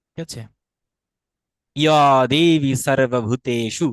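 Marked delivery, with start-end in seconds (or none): 1.8-3.9 slow, loud 'ya devi sarvabhuteshu' for ইয়া দেবী সর্বভূতেষু; stretched vowels and true 's' in ইয়া দেবী সর্বভূতেষু; Sanskrit recitation. Shown as a demonstration. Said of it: "দন্ত উচ্চারণ হবে". (সংস্কৃত উচ্চারণে ইয়া দেবী সর্বভূতেষু)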